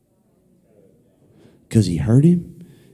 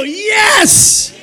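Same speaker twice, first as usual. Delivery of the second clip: high cheered call for the crowd to echo; cheering; 3 dB louder than usual. Shown as a demonstration. Preacher prompting everybody to go yes, high cheer. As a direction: lead the congregation in a high cry. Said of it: "Everybody go".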